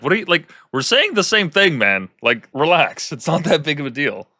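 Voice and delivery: fed-up tourist pleading for the lady to relax; frustrated tourist. Retold or imitated. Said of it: "getting visibly frustrated".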